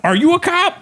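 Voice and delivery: very high incredulous question; mock suspicion. Comic accusing an audience member, high-pitched mock suspicion.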